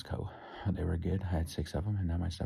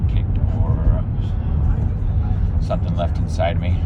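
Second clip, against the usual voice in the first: loud; 12 dB louder than usual. Real, not acted. Loud narration in car.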